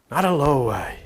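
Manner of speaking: low, quiet low way. Pretend. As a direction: drop down low and soft for low way. Contrast low way.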